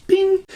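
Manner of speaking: high bright bell-like bing; ping it out like a bell; sound effect ding. Pretend.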